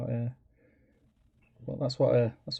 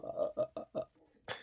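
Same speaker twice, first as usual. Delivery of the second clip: soft, breathless starstruck stammering; speechless stammer; 8 dB quieter, much slower than usual. Retold or imitated. Meeting his hero, a soft whispered starstruck stammer. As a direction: go speechless, just whispered uhs. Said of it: "I was like".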